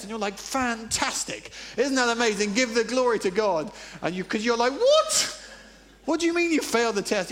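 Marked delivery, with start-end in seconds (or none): 0.4-3.7 higher, louder gushing parent; mock delight. Parent praising a child who failed the test, mock delight. 4.7-7.3 high, loud incredulous outburst; shocked parent. Acted. Parent to a child who failed a test, acted shock.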